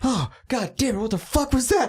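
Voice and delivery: high, baffled fish voice; dazed fish. Pretend. Released fish complaining, high bewildered voice. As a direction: sputter it like a stunned fish back in the water. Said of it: "He's like".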